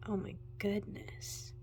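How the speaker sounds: breathless whisper of awe; awed whisper. Real, not acted. Vlogger whispering to viewers in awe. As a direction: whisper it, amazed by the colours.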